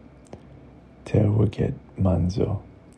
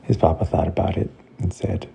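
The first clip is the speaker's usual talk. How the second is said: ordinary delivery, nothing marked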